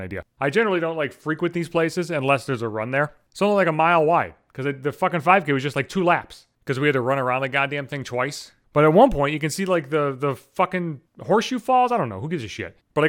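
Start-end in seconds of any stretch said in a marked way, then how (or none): none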